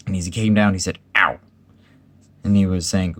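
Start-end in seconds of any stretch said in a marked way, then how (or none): 1.2-1.4 short sharp yelp; bumped "ow". A narrator's reading. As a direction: yelp as if landing with a bump. Piglet landing with a bump.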